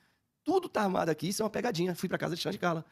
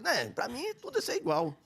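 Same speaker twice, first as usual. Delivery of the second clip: dismissive; 8 semitones higher than usual. Retold or imitated. Dismissive reply to the pastor.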